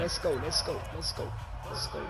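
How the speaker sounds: low, soft murmur to himself; muttered hype. Real, not acted. Gamer muttering encouragement to himself before the snap, low hushed. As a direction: urge yourself on under your breath.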